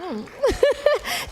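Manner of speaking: a breathy high giggle. Real, not acted. Host giggling at fans' kind words, high giggle. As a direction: giggle lightly at the sweet words. Giggling after fan thanks.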